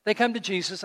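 ordinary talk, nothing marked

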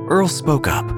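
ordinary delivery

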